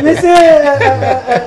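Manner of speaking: real laugh breaking into the words. Real, not acted. Host reading the closing credits to viewers, laughing.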